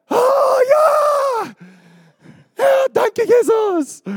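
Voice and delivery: hammed-up squeal of delight; mock delighted. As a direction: play an overjoyed churchgoer cheering. Mock delighted listener reaction.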